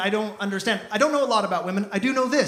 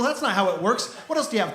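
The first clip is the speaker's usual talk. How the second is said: normal talking voice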